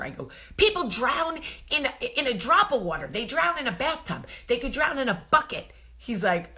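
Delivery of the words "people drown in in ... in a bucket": high, loud, frantic insisting; heated insistence. Retold or imitated. Wife arguing with husband about drowning, high frantic insistence. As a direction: hammer each example home in a panic.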